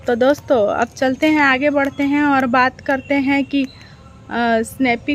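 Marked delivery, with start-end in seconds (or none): none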